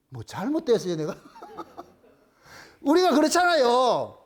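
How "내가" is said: usual speaking voice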